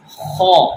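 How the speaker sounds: خو said full and heavy from the throat; heavy, full خو for learners. Shown as a demonstration. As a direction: push خو out thick from the back. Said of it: "خو کو آپ نے موٹا اور پر پڑھنا ہے".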